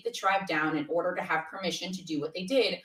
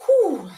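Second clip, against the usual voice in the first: high, loud exhaled whew; acted-out cry of being overwhelmed; big relieved whew; 10 semitones higher, 10 dB louder than usual. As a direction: blow out an exaggerated sigh of release. Acting out riding a big feeling.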